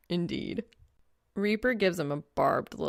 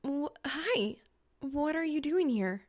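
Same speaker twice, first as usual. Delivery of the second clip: higher, startled and embarrassed; flustered girl; 8 semitones higher than usual. Acted. Reaper caught in her costume by Soul, flustered and higher. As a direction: stammer it out caught off guard.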